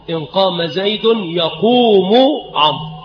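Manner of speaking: يقوم said yaqūmu with long ū; nominative verb. Shown as a demonstration. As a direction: sound the final damma on يقوم. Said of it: "فيجوز الرفع".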